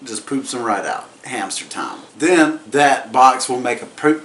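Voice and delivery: ordinary delivery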